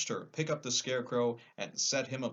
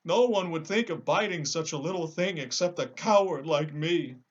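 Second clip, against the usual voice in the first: sad lion; 6 semitones higher, 6 dB louder than usual. Acted. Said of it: "continued the lion sadly".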